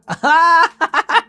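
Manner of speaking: loud high laugh; a sudden real cackle; burst of laughter. Real, not acted. Laughs at shot o'clock line.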